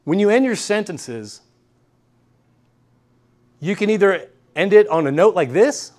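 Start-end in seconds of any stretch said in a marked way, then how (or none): 4.5-5.9 rising tune that finishes high on "this"; ending on a high note. Shown as a demonstration. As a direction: lift THIS up at the end like a question. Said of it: "like a high note".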